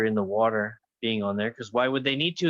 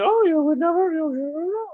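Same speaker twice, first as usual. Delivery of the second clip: very high, louder parrot voice mimicking a phone call; parrot imitation; 5 dB louder than usual. Owner imitating how her parrot mimics phone calls, high squawk. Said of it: "It mimics phone calls".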